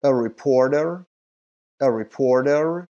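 reporter said slowly and loudly, every sound clear; slow, loud model of "A reporter" for students to copy; teacher's careful loud model; clear model pronunciation. Shown as a demonstration. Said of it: "لطفاً به اون چیزی که میشنوید دقت کنید".

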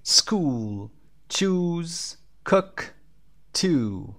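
words read out slowly and clearly; model word list. Shown as a demonstration. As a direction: model each word for the class to repeat. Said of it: "همهٔ اینها اوی کشیده هستن به جز cook".

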